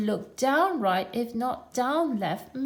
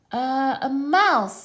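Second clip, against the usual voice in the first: hesitant answer; 5 semitones higher, 8 dB louder, much slower than usual. A narrator's reading. Mister Dizzy answers hesitantly.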